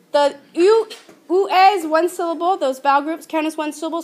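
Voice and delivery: ordinary talk, nothing marked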